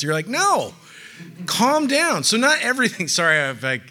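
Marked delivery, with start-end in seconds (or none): 0.3-2.2 high-pitched mock protest; lightly acted exasperated retort; mock exasperation. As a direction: rein in an overexcited churchgoer, voice raised in pitch. Acted exasperated reply to doom-mongers.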